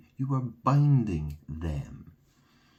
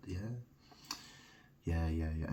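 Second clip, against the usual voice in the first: ordinary talk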